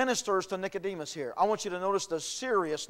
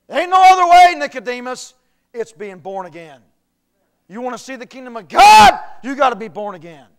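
loud, much higher sermon cry; preaching at full force; 5 semitones higher, 18 dB louder than usual. Real, not acted. Preacher insisting to Nicodemus, in a loud sermon cry. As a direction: cry it out to the crowd.

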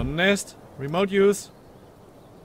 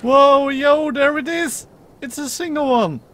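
excited discovery; 8 semitones higher, 3 dB louder than usual. Real, not acted. Egg hatched, spotting the baby.

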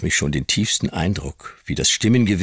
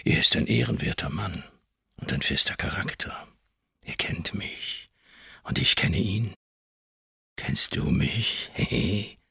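menacing whisper; 2 semitones higher, 5 dB quieter than usual. A narrator's reading. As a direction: whisper it like a creeping threat. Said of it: "sein Flüstern".

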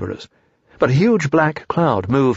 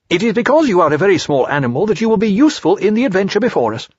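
Rabbit voice; 8 semitones higher, 3 dB louder, faster than usual. Acted.

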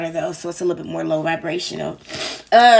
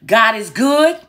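call out; 4 semitones higher, 7 dB louder, slower than usual. Shown as a demonstration. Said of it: "if I was to ask you this question, how would you answer it?".

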